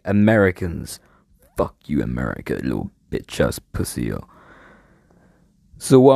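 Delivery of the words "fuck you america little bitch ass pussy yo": much lower, mocking trash talk; mock tough guy. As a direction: drop deep into a mock gangster taunt. Mock insult aimed at America.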